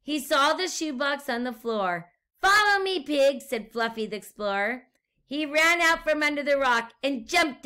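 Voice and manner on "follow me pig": high, loud rallying call; explorer's call. A narrator's reading. Explorer guinea pig calls to the pig, high and loud. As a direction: lead on boldly.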